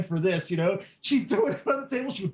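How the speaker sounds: ordinary talk, nothing marked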